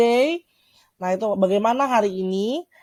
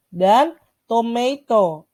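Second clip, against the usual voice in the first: slow vocabulary model; much slower than usual. Shown as a demonstration. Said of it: "ini berikut ada bahasa Inggrisnya".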